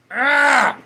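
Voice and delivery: sudden high loud cry of alarm; startled yelp. Real, not acted. Streamer suddenly startled mid-sentence, sharp yelp.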